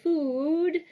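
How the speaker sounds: "food" with a long, rounded oo; open-mouthed "food". Shown as a demonstration. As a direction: stretch the oo in "food" as if making room for a bite. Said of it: "you open your mouth".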